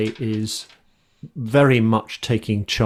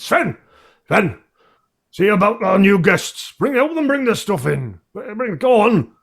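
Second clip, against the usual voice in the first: loud, high barked commands to the servant; barking orders; 10 semitones higher, 4 dB louder than usual. Acted. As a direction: bellow at the lazy boy. Barman barks orders at servant.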